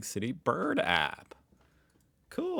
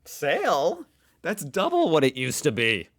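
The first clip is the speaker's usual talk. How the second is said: high, loud disbelieving cry over the price; indignant shopper; mock outrage; 5 semitones higher, 6 dB louder than usual. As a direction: act shocked at the sticker. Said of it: "I'm like".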